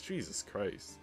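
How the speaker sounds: normal talking voice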